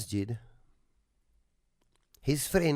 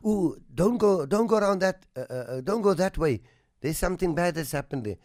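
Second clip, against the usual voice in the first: put-on higher and louder, a fretting mother calling her child back, then hurrying on faster; worried mother's warning; 5 semitones higher, 4 dB louder, faster than usual. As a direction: play the anxious mom shooing her kid from danger.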